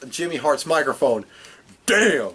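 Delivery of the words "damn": catchphrase shout, high and punchy; Ron Simmons. Retold or imitated. Podcaster mimicking a wrestler's usual bit, high emphatic shout. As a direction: belt out one exclamation, pitched up. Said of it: "Ron Simmons does his usual bit".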